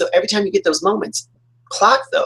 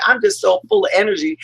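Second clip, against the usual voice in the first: high, bubbling burst of energy; fired up; 13 semitones higher than usual. Retold or imitated. Describing being too fired up to sleep, high excited gush. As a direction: gush as if wound right up. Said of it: "when I'm that fired up".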